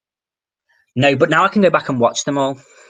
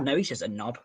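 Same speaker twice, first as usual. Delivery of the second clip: normal talking voice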